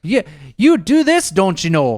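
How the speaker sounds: high, singsong Wisconsin lilt; Wisconsin accent. Retold or imitated. Imitating a Wisconsin local for a friend, hammed-up Wisconsin accent. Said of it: "you'll hear somebody talk from Wisconsin".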